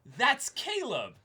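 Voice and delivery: high, loud, drawn-out knowing viewer; big mock fan recognition, slow and high; viewer going "ah". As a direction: play the delighted fan who saw it coming. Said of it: "and goes".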